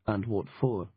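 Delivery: plain reading voice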